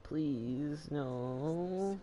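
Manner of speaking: ordinary talk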